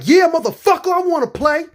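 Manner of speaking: high, loud, explosive answer; fed-up shout. Retold or imitated. Seatmate jumping up to fight, shouting it back. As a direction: finally snap and accept the challenge. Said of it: "He jumped up and was like".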